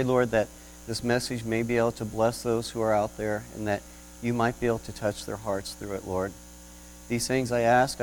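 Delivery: soft, hushed prayer voice. Acted. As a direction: pray quietly with bowed head. Opening prayer before sermon.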